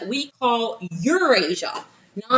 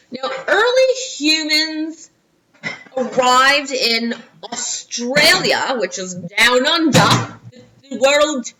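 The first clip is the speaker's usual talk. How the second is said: drawn-out put-on Australian accent, slow and loud; 3 semitones higher, 4 dB louder, slower than usual. Shown as a demonstration. Teacher shows off her Australian accent to the class, slow put-on Aussie. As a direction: ham up an Australian accent, stretching the words. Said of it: "This is my Australian accent".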